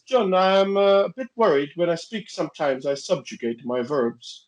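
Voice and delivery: imitated careful Swedish-accented English, starting high; worried Swedish caller, voice easing down; Swedish friend. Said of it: "she rang me up another time and goes".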